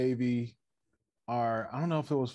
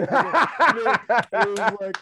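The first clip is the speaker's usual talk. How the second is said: high, loud, slow words through laughter; laughing hard; 8 semitones higher, 10 dB louder, much slower than usual. Real, not acted. Friend cracking up while retelling a wrestling moment. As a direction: crack up mid-sentence.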